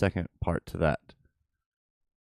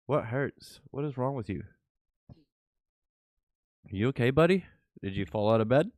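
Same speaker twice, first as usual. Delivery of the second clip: tender pet talk; 4 semitones higher than usual. Real, not acted. Cooing to pet on waking.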